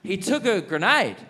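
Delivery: high, emphatic exasperation; exasperated. Retold or imitated. Veteran tired of pain questions, exasperated. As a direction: push the pitch up, fed up and incredulous.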